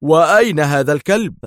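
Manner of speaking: forceful, commanding interruption; stern. A narrator's reading. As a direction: cut in severely with the question. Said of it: "قاطعه دار في صرامة".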